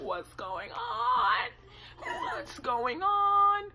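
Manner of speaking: high, drawn-out cry of alarm; mock panicked wail. Applicant as the lights go off, mock high wail. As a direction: stretch it out high, hamming up the panic.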